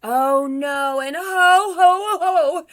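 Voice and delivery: high, very loud drawn-out ho-ho; booming Santa. Acted. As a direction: belt it out as a startled Santa. Santa is caught by bears.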